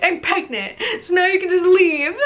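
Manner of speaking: high, loud retold blurt; hammed-up retold outburst; dramatic outburst. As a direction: fling the news at him and tell him to leave. Throws test at him, assumes worst.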